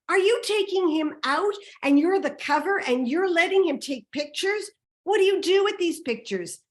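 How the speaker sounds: high, loud barrage of accusing questions; retold scream. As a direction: hurl outraged questions, pitch climbing. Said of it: "I scream".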